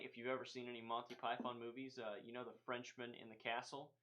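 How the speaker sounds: ordinary delivery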